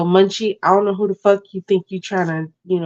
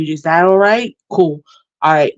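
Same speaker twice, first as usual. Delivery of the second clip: ordinary talk, nothing marked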